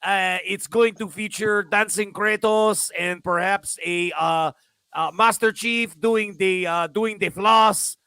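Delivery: slow, hammed-up French accent; a lightly put-on French accent, drawn out; mock French accent. Mocking Ubisoft developers pitching a new game, in a slow mock French accent. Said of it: "Oh, sorry, they're French".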